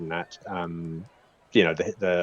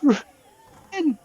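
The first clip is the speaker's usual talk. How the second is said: much higher, pleading halfling voice calling through tears; sobbing halfling woman; 5 dB louder than usual. Acted. Sobbing halfling begs Ruben to come back, high and pleading. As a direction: cry out after Ruben in a high voice. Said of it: "sobbing on the ground and going".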